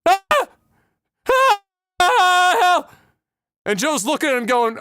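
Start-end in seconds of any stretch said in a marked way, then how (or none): 0.1-2.9 high, loud wounded screams; hammed-up wail of the injured man; screaming for help. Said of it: "he's screaming".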